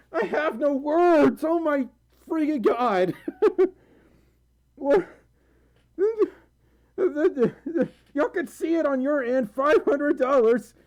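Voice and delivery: high, loud, slowed disbelief; overwhelmed excitement. Real, not acted. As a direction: gush in stunned delight. Overwhelmed by donation.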